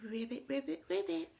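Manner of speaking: quick string of playful ribbits; pretend frog ribbits. Characters pretend together at the end, playful frog ribbits. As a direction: croak ribbits like a little frog. Frog noises ending the story.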